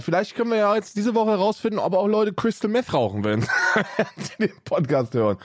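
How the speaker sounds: high, laughing through words; laughing while talking. Real, not acted. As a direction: giggle through it.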